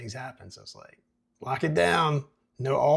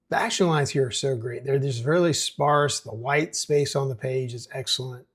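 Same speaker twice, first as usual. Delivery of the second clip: usual speaking voice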